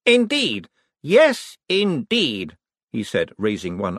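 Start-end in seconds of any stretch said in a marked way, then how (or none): 0.1-2.6 high, lordly affirmation; imperious. A narrator's reading. Sick man playing Lord Vetinari, imperious. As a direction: draw yourself up and pronounce it grandly. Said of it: "a spark of imperiousness shone through".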